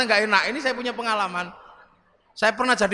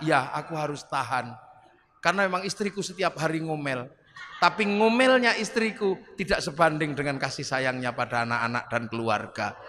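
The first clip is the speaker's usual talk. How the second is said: calm sincere reply; 9 semitones lower, 3 dB quieter than usual. Acted.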